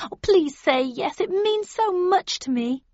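high, imploring plea; girlish pleading, drawn out at the end. A narrator's reading. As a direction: beg them to say yes. Friend begging for a yes.

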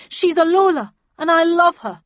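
high, loud angry outburst; angry shout. A narrator's reading. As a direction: shout it out of love. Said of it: "snarled Randolph angrily".

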